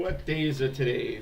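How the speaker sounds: ordinary delivery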